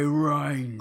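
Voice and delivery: gruff put-on orc bark. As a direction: growl the name like a hulking orc. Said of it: "come across and say".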